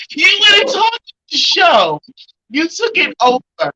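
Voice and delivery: excited gushing. Real, not acted. Host gushing with emotion.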